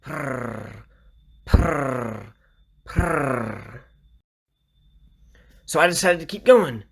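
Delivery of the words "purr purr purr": rolled, trilled purr; imitated cat purr. Man retelling how a petted cat purred, trilled purr. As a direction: purr like a contented cat in short falling trills. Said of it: "the cat kept".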